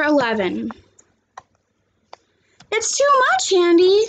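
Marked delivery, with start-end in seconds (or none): 2.7-4.0 put-on girl's voice, much higher, pleading; worried schoolgirl, pitched way up; Kayla's voice. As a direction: plead with him in a high young girl's voice. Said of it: "Kayla said".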